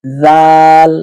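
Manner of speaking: "জাল" with a voiced th, held long; tongue between teeth. Shown as a demonstration. Teacher repeating the correct letter, "জাল" as dhal. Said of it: "দুই দাঁতের মাঝখানে জিহ্বা আসবে".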